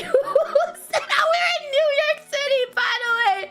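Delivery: high, rushed words through laughter; incredulous laughter. Real, not acted. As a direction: gabble it while cracking up.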